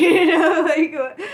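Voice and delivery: loud high laughing. Real, not acted. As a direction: laugh through it, nearly crying. Laughing at past self.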